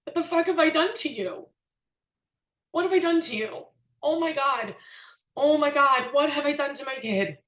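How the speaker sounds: real crying while reliving hurting her son; real tearful. Real, not acted. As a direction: let the tears break through the words. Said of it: "This always makes me cry".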